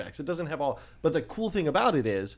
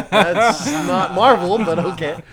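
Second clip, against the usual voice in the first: loud, slow laughing protest; 5 semitones higher, 11 dB louder, much slower than usual. Real, not acted. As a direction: laugh the words out, much higher. Laughing at a joke.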